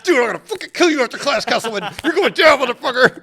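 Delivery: high, loud playful menace; mock threat. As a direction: throw a joking threat at a classmate. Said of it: "And they'd be like".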